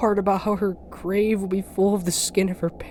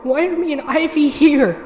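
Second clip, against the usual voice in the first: higher, louder angry demand; 8 semitones higher, 5 dB louder than usual. Acted. Trapped girl demanding answers from the ferryman, angry and loud. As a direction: snap back at him, furious and scared.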